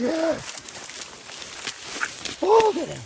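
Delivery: high thrilled cries after the shot; real rush of triumph; excited. Real, not acted.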